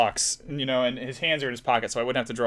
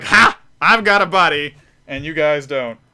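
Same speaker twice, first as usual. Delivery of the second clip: smug gloating; 3 semitones higher, 10 dB louder than usual. Acted. Artist voices drawn duck to the others, smug gloating. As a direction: lord it over the others with a loud "Ha!". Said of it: "he's sitting here smug".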